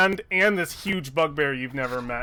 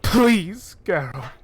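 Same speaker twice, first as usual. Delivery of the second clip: a touch of high tearful begging; weeping plea; 3 semitones higher, 3 dB louder, much slower than usual. Acted. Kneeling woman begs the Manticore, lightly tearful pleading. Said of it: "she's just weeping".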